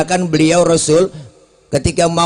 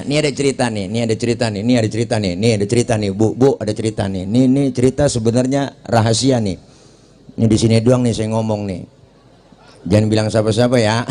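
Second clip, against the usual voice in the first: gossip voice; 7 semitones lower, faster than usual. Acted. Gossiping neighbour spilling a secret.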